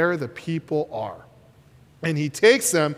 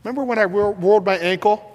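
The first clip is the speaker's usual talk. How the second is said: normal talking voice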